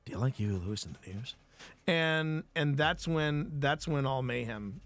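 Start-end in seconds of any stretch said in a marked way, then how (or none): 0.1-1.3 lower, quicker, deadpan movie-killer read; American Psycho line delivery. Shown as a demonstration. Streamer showing chat how the movie line should sound, lower and faster. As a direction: deliver it like the menacing film scene. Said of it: "it's the delivery of the line".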